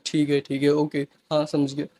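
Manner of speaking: quiet, soothing retold agreement; calm reply. Son answering his angry dad, soft and calm. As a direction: replay how he calmly took it. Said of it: "कामली बात कर रहा हूँ".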